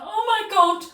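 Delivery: very high, loud mock shriek; acted panicked scream. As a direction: scream as if finding a horse indoors. Retold screaming at horse indoors.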